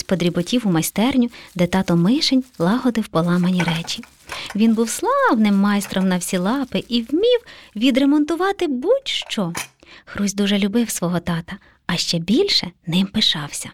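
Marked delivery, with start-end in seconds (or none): none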